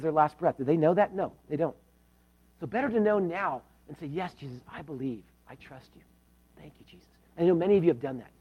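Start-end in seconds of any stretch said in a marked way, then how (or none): 4.1-7.1 much softer, fading to near silence; whisper-soft yes to Jesus; hushed prayer. Acted. Believer quietly saying yes to Jesus, hushed and fading.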